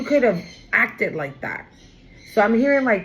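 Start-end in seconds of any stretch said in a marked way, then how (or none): none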